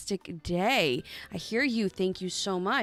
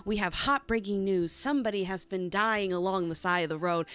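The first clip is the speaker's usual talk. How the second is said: ordinary delivery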